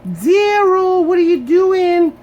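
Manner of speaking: high, loud calling question; mom yelling. Retold or imitated. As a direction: shout it like a mom checking on her kid. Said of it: "my mom would yell out".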